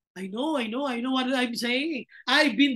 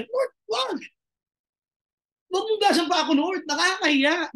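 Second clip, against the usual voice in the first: embarrassed Peter; 6 semitones higher than usual. Acted. Peter refusing to be washed.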